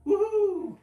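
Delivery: loud high owl hoot. Acted. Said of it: "Owl called".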